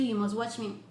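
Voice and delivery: ordinary delivery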